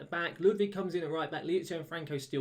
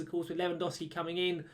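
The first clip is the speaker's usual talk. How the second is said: ordinary talk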